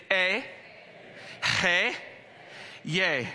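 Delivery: eh, hey, yay, each syllable called out slowly for the room; sounded out. Shown as a demonstration. Preacher leading the crowd through Eh, hey, yay. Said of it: "Say that with me".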